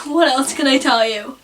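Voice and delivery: usual speaking voice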